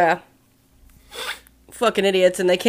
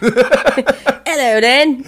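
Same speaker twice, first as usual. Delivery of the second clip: lightly mocked British greeting; put-on British accent; 3 semitones higher, much slower than usual. Posing as a British fan, put-on British accent. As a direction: greet like a pretend Brit. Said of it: "me making different accents".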